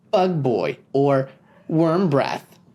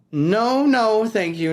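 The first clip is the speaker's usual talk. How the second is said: higher, polite but pressed; firm refusal; 8 semitones higher, slower than usual. Acted. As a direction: decline quickly. Peter refusing again.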